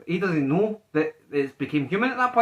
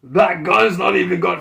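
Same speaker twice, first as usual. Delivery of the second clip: attempted English accent, slow and raised; 3 semitones higher, 6 dB louder, slower than usual. Friend retelling Butcher's line, attempted English accent. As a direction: have a stab at an English accent. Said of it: "English accent?".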